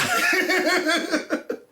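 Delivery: high-pitched burst of laughter; real incredulous laugh. Real, not acted. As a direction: crack up at the absurdity. Laughs at the absurd claim.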